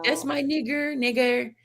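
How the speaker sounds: a hint of the kids' playful greeting; imitating the kids casually; mock kid voice. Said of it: "these Latin kids is like".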